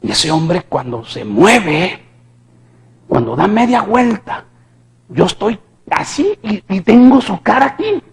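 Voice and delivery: usual speaking voice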